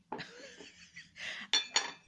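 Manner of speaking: a breathy high-pitched giggle. Real, not acted. Giggling about not drinking coffee. Laughing at own joke.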